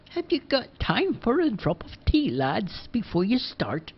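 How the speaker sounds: a hint of a kindly old neighbour; Missus Dockery. Missus Dockery offering the lads tea, lightly voiced old lady.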